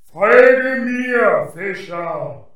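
commanding spirit voice. Acted. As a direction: order him to follow. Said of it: "Der Geist lachte und sagte".

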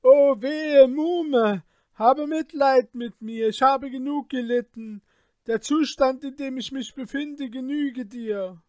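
high pleading cries, loud at first; crying out in pain. A narrator's reading. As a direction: beg for mercy through the pain. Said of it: "Ihr Vetter schrie".

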